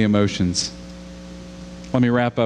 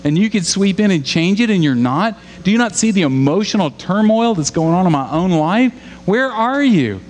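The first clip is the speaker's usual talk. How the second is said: high, louder desperate questioning; anguished plea pitched up and louder; David pleading with God; 9 semitones higher, 3 dB louder than usual. Retold or imitated. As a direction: press God for answers, straining high. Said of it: "David is saying".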